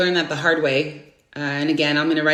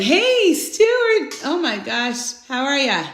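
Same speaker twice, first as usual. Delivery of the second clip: excited greeting; 7 semitones higher, much slower than usual. Real, not acted. Greeting a viewer joining live.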